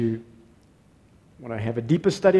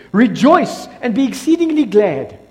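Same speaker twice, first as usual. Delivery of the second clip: proclaiming command; 7 semitones higher, 7 dB louder than usual. Acted. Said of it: "Jesus says".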